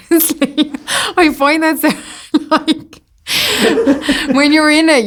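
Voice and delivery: laughing. Real, not acted. Laughing while talking about forgetting lines.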